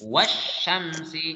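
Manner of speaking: "Wasyamsi" drawn out slowly, alif lam silent, straight into the doubled sh; teacher shows the silent alif lam in "Wasyamsi"; correct tajwid reading. Shown as a demonstration. Teacher models Quran reading for pupils, slow and careful. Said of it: "alif lam ketemu dengan shin".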